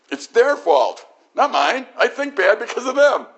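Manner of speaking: high, loud, whining excuse; whiny blame. Retold or imitated. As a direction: whine that it's someone else's fault.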